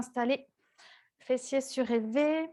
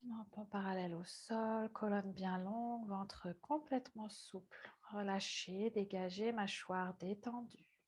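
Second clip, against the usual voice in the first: soft, low-key yoga cueing; hushed meditation guidance; 4 semitones lower, 14 dB quieter than usual. Real, not acted. Yoga teacher cueing students mid-breathing exercise, hushed meditative voice. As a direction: murmur calm reminders without disturbing their breathing.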